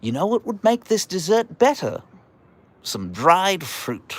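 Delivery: high, put-on voice, slow on the last words; mocking the British. Streamer imitating the British suggesting raisins, high slow mock voice. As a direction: lampoon a smug Brit pitching dried fruit. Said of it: "They're like".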